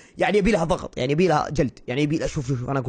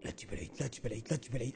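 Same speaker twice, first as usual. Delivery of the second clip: very low, fast repeated mutter; nervous muttering; 10 semitones lower, 18 dB quieter, much faster than usual. Real, not acted. Gamer warning himself not to mess up, fast mutter. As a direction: mutter it rapidly under your breath. Nervous self-talk while playing.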